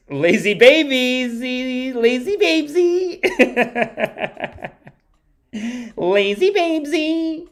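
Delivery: high, drawn-out cutesy coo; playful high-pitched baby voice; cutesy baby talk. Pretend. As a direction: squeal it slowly like teasing a baby. Playful cooing nickname, high put-on voice.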